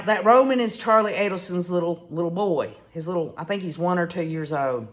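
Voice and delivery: ordinary talk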